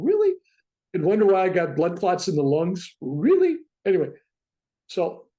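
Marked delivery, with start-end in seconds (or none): 0.0-0.3 high, loud disbelieving "Really?"; incredulous. Retold or imitated. Retelling the flight home, high incredulous "Really?". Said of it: "I'm going". 3.0-3.6 incredulous. Real, not acted. Ironic comment on blood clots.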